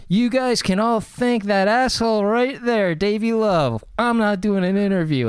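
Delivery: hammed-up indignant announcement; mock angry outburst. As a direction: imitate an irate refusal. Retells star's angry door slam.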